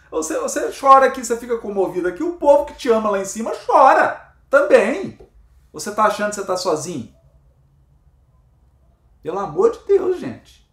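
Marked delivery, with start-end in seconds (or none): none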